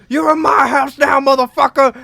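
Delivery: high, loud interrogation shout; hammed-up movie tough-guy holler; Tracy Morgan, bad cop. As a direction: yell it like an over-the-top bad cop. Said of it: "Tracy Morgan's like".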